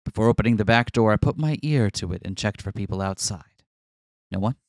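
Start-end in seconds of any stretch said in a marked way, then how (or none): 1.1-4.6 measured, even narrator read; dramatic visual novel narration; story narration. Acted. Reads game narration aloud.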